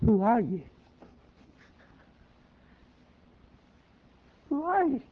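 high, hushed puzzled question; bewildered asking. Acted. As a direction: ask in confusion. Saul bewildered.

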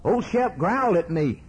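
high-pitched retold child; upset boy. Boy tells his father of the growl, upset child voice. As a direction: lightly act a boy telling on his dog. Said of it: "he said".

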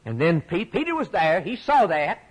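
usual speaking voice

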